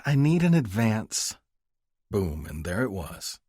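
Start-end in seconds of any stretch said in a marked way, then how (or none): 0.0-1.4 high, slow pleading mother. Acted. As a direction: ask sheepishly for money. Mother asks for money.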